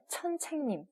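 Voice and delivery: 천책님 with ch for s, high and soft; imitated Chinese learner. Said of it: "이렇게 발음해요".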